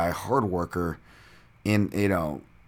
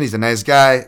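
emphatic defense; 5 semitones higher, 11 dB louder than usual. Real, not acted. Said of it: "stop creeping at your listeners".

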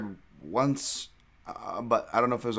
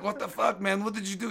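detached fighter; 8 semitones higher, 5 dB quieter than usual. Retold or imitated. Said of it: "then he's like".

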